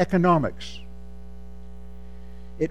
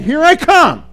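loud, high proclamation; bold declaration; 9 semitones higher, 7 dB louder, slower than usual. Shown as a demonstration. Preacher modelling the line for friends, loud and bold. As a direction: call it out proudly. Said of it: "you need to say the same thing".

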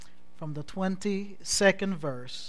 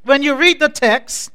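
loud, raised, driving sermon delivery; impassioned preaching; 8 semitones higher, 17 dB louder than usual. Real, not acted. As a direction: preach it out to the whole church, drawing out the refrain.

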